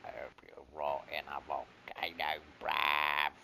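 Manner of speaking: low hushed threat; a hushed mock threat; mock whisper. Whispered mock curse while gaming.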